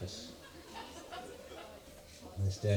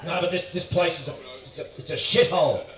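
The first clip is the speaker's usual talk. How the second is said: higher-pitched protest, mildly freaking out; mock freak-out; 5 semitones higher, 3 dB louder than usual. Australian guest complaining to his posh host, mock freak-out. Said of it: "I'm fucking freaking out".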